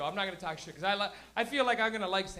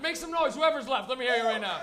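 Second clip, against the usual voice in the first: hype call; 3 semitones higher, 6 dB louder than usual. Real, not acted. Host rallying the late crowd, loud hype shout. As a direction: pump up the room. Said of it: "host hyping crowd".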